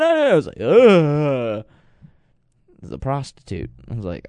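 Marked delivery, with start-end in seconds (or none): 0.6-1.6 loud, short ugh of revulsion; grossed-out ugh; disgusted groan. Real, not acted. Disgusted at wig reveal.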